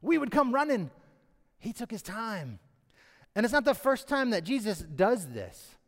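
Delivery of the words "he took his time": softer repeat of the same line; quiet emphasis. Shown as a demonstration. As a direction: let it sink in, softly. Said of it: "Does the opposite of what you would expect".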